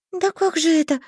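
put-on high, flustered girl; mumbling. A narrator's reading. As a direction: babble it nervously, high. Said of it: "забормотала я изо всех сил".

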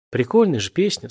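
ordinary delivery

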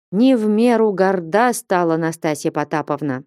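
ordinary delivery

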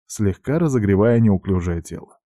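ordinary delivery

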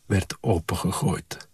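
ordinary delivery, nothing marked

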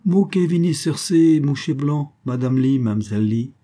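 a lightly voiced Indian captain in Creole; Creole speech. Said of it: "capitaine Wempi".

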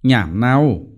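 short, lightly reproving remark; mild scolding. A narrator's reading. Mother frowning at her little boy's answer, mild scolding. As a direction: gently tell the child off. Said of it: "mắng nó".

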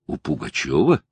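mildly surprised question, voice rising at the end. A narrator's reading. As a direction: echo the name back in quiet surprise. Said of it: "удивился я". (удивлённо переспрашивая)

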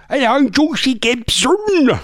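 high, slow caricature voice; Josie Gibson. Acted. Host attempting a TV presenter's voice, high caricature. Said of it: "I can't remember how she talks now".

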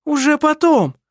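high, loud, defensive cry; shouted retort. A narrator's reading. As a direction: shout back in self-defence. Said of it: "крикнула Лисин".

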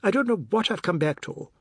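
high-pitched exasperated outburst; worked-up, high complaint; shouting. A narrator's reading. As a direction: protest at breaking point. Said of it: "Oad shouted".

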